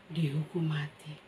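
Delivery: hushed unvoiced whisper. Real, not acted. Teacher telling children the death sentence in a whisper. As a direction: drop to a whisper. Grim answer.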